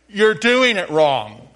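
slow, loud, know-it-all put-down; smug, drawn-out correction; with an attitude. Shown as a demonstration. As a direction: lean on doing and let it fall like a scolding. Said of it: "you gotta say it with an attitude".

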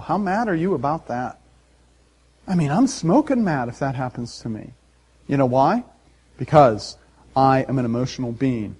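ordinary talk, nothing marked

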